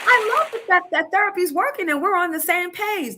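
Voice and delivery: high, sarcastic impression of the husband; mock partner voice. Mocking the husband at therapy, in a high sarcastic voice. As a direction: mimic him in a high put-on voice.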